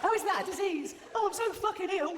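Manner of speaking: high, whiny mock-sick moaning. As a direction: whine like a fake invalid. Mocking the obese woman's claim.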